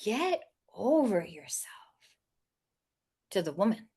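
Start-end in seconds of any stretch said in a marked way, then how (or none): 0.0-2.0 slow, whispered deadpan put-down; whispered dog voice, slow; dog's whispered retort. Retold or imitated. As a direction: breathe it out as the sassy dog. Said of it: "he says".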